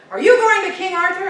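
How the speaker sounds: loud, high hail from horseback; calling out. A narrator's reading. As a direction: shout it across the field. Red Knight calls out.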